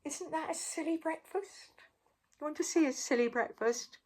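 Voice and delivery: ordinary delivery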